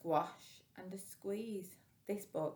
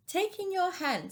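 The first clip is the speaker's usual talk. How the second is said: put-on high, louder sing-song old man's advice; wise old man; 12 semitones higher, 6 dB louder than usual. Storyteller voicing the wise old man's advice. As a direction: pitch the old man's line up high and bright. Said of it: "said the wise old man".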